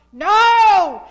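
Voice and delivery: loud, high shouted no; emphatic no. Real, not acted. Preacher rejecting ten-minute devotions, shouted no. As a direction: bark a flat refusal at the room. Rejects half-hearted prayer habits.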